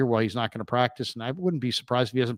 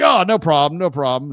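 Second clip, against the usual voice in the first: suddenly friendly; 3 semitones higher, 7 dB louder than usual. Retold or imitated. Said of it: "he's like".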